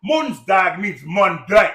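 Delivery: Mondsdag drawn out slowly and shouted; slow emphatic. Shown as a demonstration. Preacher hammering the word Mondsdag at listeners, slow and loud. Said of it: "I just gave you".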